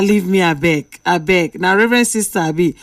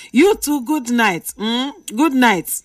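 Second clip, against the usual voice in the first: ordinary talk, nothing marked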